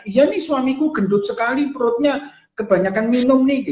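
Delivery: mock a husband's belly in jest; teasing put-down. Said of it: "sambil bercanda tapi juga merendahkan". (ejekan bercanda dengan nada tinggi)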